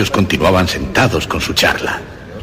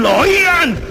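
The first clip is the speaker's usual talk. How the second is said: lo oían leaping much higher, almost shrieked; near-shriek; slower than usual. Acted. The murderer sure the officers hear it, near-shrieking. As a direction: panic breaking through. Convencido de que lo oyen.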